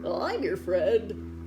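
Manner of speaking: slow, loud and warm; smiling uncle. Acted. Uncle comforting lonely Elmore, slow and smiling. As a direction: reassure him with a smile. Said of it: "his uncle smiled".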